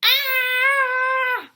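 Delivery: high, loud drawn-out scream; hammed-up scream of fright; piercing scream. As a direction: shriek in terror. Gerald screams at bird.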